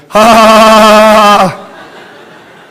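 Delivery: high, loud sing-song cackle fading to breath; manic laugh. A narrator's reading. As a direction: cackle like a madwoman.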